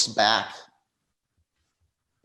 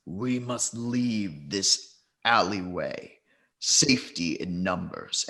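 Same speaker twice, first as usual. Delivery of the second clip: slow, measured, lower character voice; slit-throated man; 4 semitones lower, 4 dB quieter, slower than usual. Acted. Man with slit throat urging the group out, slow and grave. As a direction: draw each word out slowly, weighty and low. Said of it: "slit throat says".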